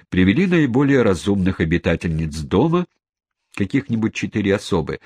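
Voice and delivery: usual speaking voice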